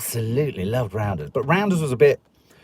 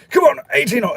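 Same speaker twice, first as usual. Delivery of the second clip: high, loud hearty posh bark; hammed-up posh dad calling him to the golf course; mock posh army father; 12 semitones higher, 5 dB louder, much faster than usual. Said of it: "It's making him sound posh, it wasn't that".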